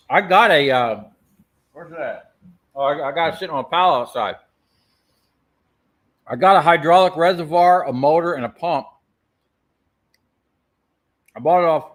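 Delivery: talking with a mouthful; words round a bite of food; wolfing down food. Real, not acted. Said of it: "I'm sorry, I'm eating".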